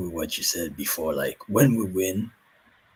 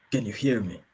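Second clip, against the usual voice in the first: normal talking voice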